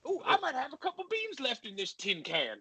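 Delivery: high-pitched prospector caricature; old-timey prospector. Acted. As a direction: put on a hammed-up gold miner voice. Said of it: "a prospector".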